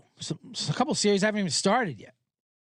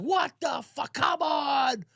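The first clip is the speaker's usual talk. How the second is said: incredulous shout; 8 semitones higher, much slower than usual. Retold or imitated. Crowd reacting to the technical foul with an outraged shout. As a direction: yell it like a stunned crowd at a bad call. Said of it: "and everyone's like".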